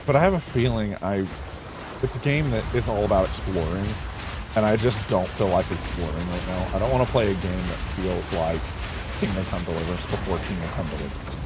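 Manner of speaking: usual speaking voice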